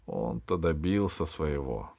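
plain reading voice

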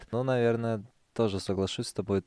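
ordinary talk

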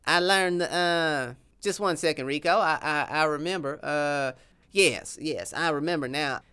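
lightly voiced Texan accent, proud of his sauce; a hint of a boastful Texas cowboy; southern accent.

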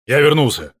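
booming comeback. Pretend. Dubbing actor plays along with the Thor joke, booming comeback. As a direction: announce your return in a big mock-heroic voice.

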